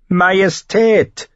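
high, slow, snapping Majestät; sharp correction. A narrator's reading. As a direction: snap at her to correct the title. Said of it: "fuhr er sie scharf an". (scharf zurechtweisend, gedehnt)

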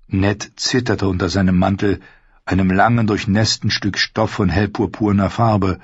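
ordinary delivery, nothing marked

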